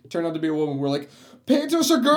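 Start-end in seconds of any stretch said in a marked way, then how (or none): 1.5-2.1 loud, very high mock shock; hammed-up yell of disbelief; astonished exclamation. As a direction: shriek it like a huge discovery. Retold group's surprised discovery.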